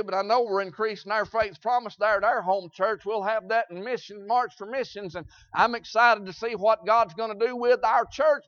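ordinary delivery

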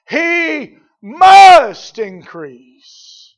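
"must" drawn out very slow and loud; stress on MUST. Real, not acted. Preacher showing the emphatic requirement, long loud stress on "must". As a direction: lean long and hard on "must".

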